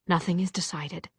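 plain reading voice

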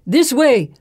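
loud, high urgent shout. A narrator's reading. Companion leading the escape to a sewer, shouting. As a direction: shout directions on the run. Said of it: "Kartik shouts".

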